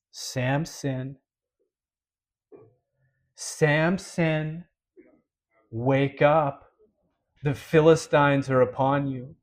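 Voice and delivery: slow, spaced-out call to a sleeper; unhurried warning with long pauses, no yelling; measured waking call. Retold or imitated. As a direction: call the name, wait, then deliver the warning gravely. Final waking, drawn out, unlike earlier yells.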